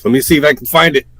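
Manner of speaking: loud, fast build-up; loud announcement. Acted. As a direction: boom it out to start the bit. Builds up a fortune-teller bit.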